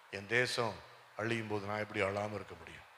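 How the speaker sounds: soft, low, sorrowful answer; subdued sorrow. Acted. Weeping man explaining himself to his neighbour, subdued sorrow. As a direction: answer quietly, with understated grief.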